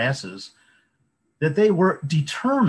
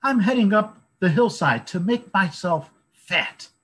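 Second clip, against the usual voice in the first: high, bright little goat; littlest goat voice; 9 semitones higher, 4 dB louder than usual. A narrator's reading. Littlest goat explains to the troll, high and small. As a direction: keep the tiny goat voice going.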